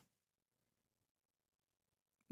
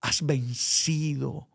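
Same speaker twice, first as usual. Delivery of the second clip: soft, slow, tender assurance; tender Jesus; 3 semitones lower, 6 dB quieter, slower than usual. Retold or imitated. Christ gently telling the weary preacher he has won, soft and slow. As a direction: speak it gently like Christ comforting a son. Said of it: "como si Jesucristo me dijera".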